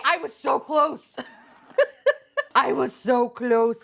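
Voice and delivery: high laughing groan; giggling so-close moan; laughing lament. Real, not acted. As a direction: laugh through the near miss. Laughing at a near miss.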